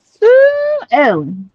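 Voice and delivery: high, very slow drawled Sue Ellen; sing-song hammed-up name call; mock drawled call. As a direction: stretch the name out like a hammed-up Southern belle. Calling in mock voice.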